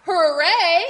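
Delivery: loud joyful cheer; cheering hooray. Acted. Reading to kids, a loud happy hooray. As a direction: celebrate the safe landing. Lands on giraffe.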